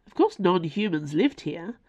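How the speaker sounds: ordinary delivery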